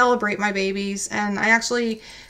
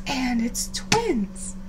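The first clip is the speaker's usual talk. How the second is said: calm reveal; 7 dB quieter, much slower than usual. Retold or imitated. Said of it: "And she goes".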